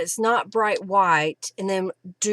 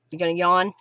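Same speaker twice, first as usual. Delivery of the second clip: normal talking voice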